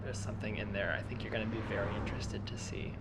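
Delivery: hushed secretive whisper. Acted. Leading her to a surprise.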